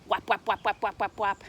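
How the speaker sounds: ordinary delivery, nothing marked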